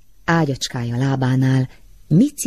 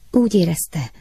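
usual speaking voice

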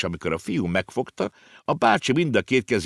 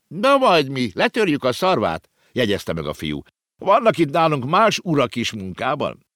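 put-on higher boyish confident voice; cocky boy; 8 semitones higher, 3 dB louder than usual. A narrator's reading. The clerk boy brags about taming adults: cocky boy's voice. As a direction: promise mischief lightly.

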